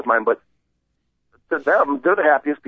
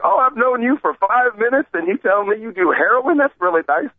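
sarcastic, pitch rising high; mock sarcastic disbelief; 8 semitones higher than usual. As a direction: play up the disbelief with mock niceness. Retold sarcastic reply to doctor.

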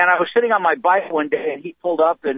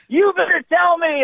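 high, drawn-out imitation of mom yelling; mock-furious maternal threat, pitched way up; screaming mother; 12 semitones higher than usual. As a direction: demand it like an enraged mom. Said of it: "she's screaming at me".

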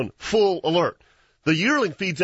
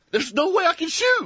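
excited; 13 semitones higher than usual. Retold or imitated. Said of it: "and I'm going".

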